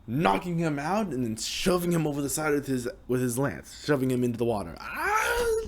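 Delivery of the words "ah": much higher yelp; high falling scream. Acted. Bandit shoved over the side, high yelp. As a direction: yelp as you go overboard.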